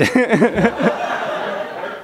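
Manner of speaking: light, breathy laughter. Real, not acted. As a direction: chuckle it out. Laughs after the closing line.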